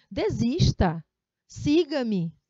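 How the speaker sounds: loud, forceful order with exclamation; emphatic command. Shown as a demonstration. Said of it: "imagina nesse tom".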